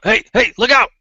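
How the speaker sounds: high, loud acted shout of alarm; yelled warning. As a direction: yell a warning across the yard. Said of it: "one of the guys yells out".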